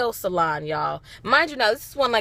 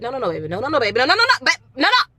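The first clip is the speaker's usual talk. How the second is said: building no's; much faster than usual. Real, not acted. Repeated denial, building up.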